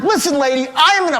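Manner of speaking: high-pitched irate retort; retold angry outburst; pissed off. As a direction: confront the caller, acting out lost patience. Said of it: "I am pissed off".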